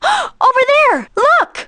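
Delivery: high, gasping cry of alarm; startled alarm. Acted. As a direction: point and cry out in shock. Spots the missing villagers.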